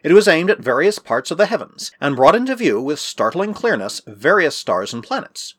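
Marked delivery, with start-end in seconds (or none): none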